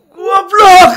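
very high, very loud shout; furious yell. Retold or imitated. Boss yelling at Yani after another mistake, furious shout. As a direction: scream the insult at full volume. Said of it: "teriak lagi".

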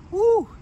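high, loud whoop of amazement; sudden high woo; excited whoop. Real, not acted. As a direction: whoop at the price tag. Hearing the car's price.

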